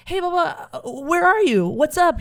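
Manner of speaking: high, eager daughter calling dad; cheery phone greeting. Acted. Daughter phoning her religious father, cheery high greeting. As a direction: pitch it up, bubbly and casual.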